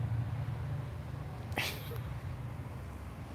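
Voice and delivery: long silent wheezing laugh; airy voiceless laughter; breathless laugh. Real, not acted. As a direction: laugh with no voice, just air.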